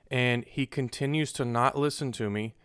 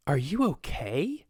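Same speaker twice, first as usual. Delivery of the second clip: slow, high, exaggerated lilting question; lady customer; 4 semitones higher, slower than usual. Seller mimics the lady who asked about him, mock concern.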